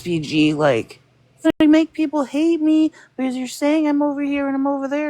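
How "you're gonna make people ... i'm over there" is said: high-pitched mock panic; freaked-out imitation. Retelling SBG's complaint about being called out, freaked-out imitation. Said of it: "freaked out and was like".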